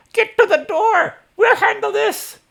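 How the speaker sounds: put-on very high, shouted old-woman voice; Elsa. Game master correcting a spry older woman's voice, very high shout. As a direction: push it way up into a piercing, loud command. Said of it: "She's like".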